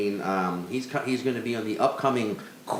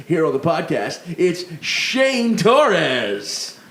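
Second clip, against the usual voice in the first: loud hyped announcer voice; booming football announcer intro; punchy late night jokes; 3 semitones higher, 4 dB louder than usual. Pretend. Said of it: "You sound like a football announcer".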